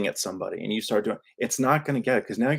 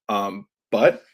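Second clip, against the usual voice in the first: usual speaking voice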